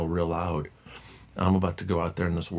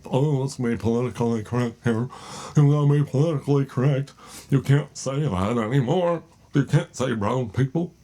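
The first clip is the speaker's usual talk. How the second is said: high, loud whiny scolding voice; mocking the politically correct; 5 semitones higher, 5 dB louder than usual. Vlogger mocking critics, high whiny scold. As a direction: whine like a prissy scold. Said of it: "but if it's like".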